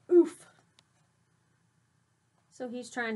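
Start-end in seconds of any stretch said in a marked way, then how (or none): 0.1-0.5 loud comic oof of a stumble; a cartoon bump grunt; mock oof. A narrator's reading. Teacher acting the bean falling over while swaggering, loud comic oof.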